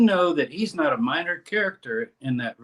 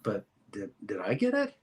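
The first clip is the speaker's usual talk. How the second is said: much softer, hushed inner question; quiet self-doubt; 4 semitones lower, 10 dB quieter than usual. Retold or imitated. Reader doubting himself: hushed, hesitant aside. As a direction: ask yourself quietly, a little unsure. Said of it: "you gotta almost ask".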